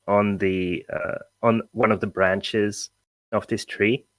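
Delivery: normal talking voice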